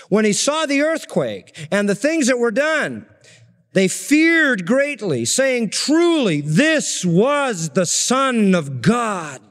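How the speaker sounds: slow, lifted reading of the verse; slowed solemn Bible reading; solemn scripture reading. Acted. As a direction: read it out with awe, drawing out each word. Reads scripture aloud.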